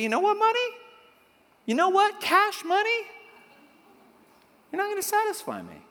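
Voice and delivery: high-pitched mock telling-off; attitude. Shown as a demonstration. Preacher telling money off for the congregation, with attitude. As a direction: call it out high, then drop the volume to dismiss it. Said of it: "say it with a little attitude".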